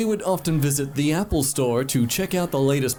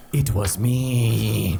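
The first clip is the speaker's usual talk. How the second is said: slow menacing taunt; 6 semitones lower, much slower than usual. Acted. Imagined confession to the kid.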